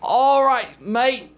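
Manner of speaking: broad Aussie, high and loud; Australian accent. Acted. [name] opening his accent-game turn in Australian. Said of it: "Well, it's Australian".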